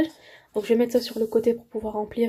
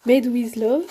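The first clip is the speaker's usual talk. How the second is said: "Made with Love" louder, in a put-on English accent; mock English accent; 6 dB louder, much slower than usual. Shown as a demonstration. Vlogger mock-proudly saying the English name, louder English accent. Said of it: "Il est là l'accent anglais".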